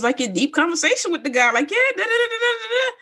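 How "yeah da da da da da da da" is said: high-pitched babbled small talk; mock chatter. Imitates self chatting away.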